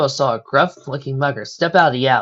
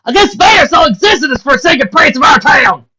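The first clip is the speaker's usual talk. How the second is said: shrieking, much higher and louder; 12 dB louder than usual. Acted. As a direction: shriek it in outrage. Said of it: "managing to shriek".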